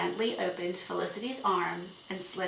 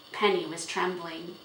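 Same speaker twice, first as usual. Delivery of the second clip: plain reading voice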